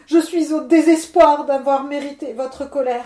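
a touch of weeping as he blames his wife; tearful plea. Acted. Miller on his knees begging Levent, mock tearful. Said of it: "dit-il en pleurant".

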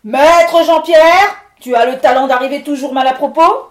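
stentor's cry. Acted. Levent roaring at the miller to go away, booming stentor shout. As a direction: bellow like a furious storm spirit. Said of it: "il lui cria d'une voix de stentor".

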